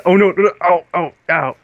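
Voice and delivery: loud, high cries of game pain; panicked ows, starting high and loud; alarmed yelps. Real, not acted. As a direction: yelp at each hit, easing off gradually. Getting hurt in the game.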